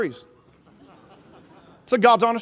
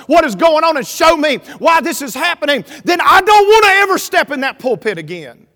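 impassioned plea to God, building higher and louder; full-force preaching; 4 semitones higher, 6 dB louder than usual. Acted. Preacher begs God for answers at his desk, full-force preaching plea. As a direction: preach a desperate prayer, stretching key words.

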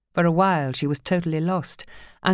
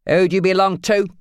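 louder, commanding question; domineering demand; 4 semitones higher, 7 dB louder than usual. Acted. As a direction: demand she submit. Phil demanding submission.

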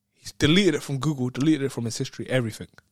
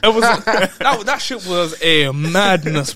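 laughing; 4 semitones higher, 5 dB louder, much slower than usual. Real, not acted. Still laughing at the trick.

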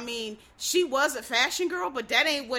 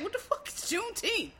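high-pitched incredulous question; puzzled, pitched well up; baffled mock reply; 10 semitones higher than usual. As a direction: imitate a kid totally thrown by the word. Students' baffled reply acted out.

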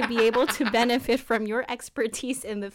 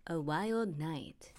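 normal talking voice

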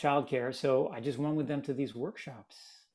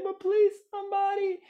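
put-on very high little-kid plea; pleading child; 4 dB louder than usual. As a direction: beg like a small boy caught between fighting parents. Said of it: "I'm just like".

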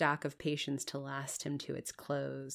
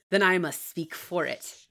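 loud, relentless pressing; 7 dB louder than usual. A narrator's reading. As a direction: press the demand forcefully, without relenting. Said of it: "continued the deep, relentless voice".

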